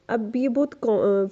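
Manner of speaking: ordinary talk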